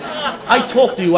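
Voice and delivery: slow, high, laughing admission. Real, not acted. Admits to the audience he made stories up, laughing slowly and high. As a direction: laugh through the words.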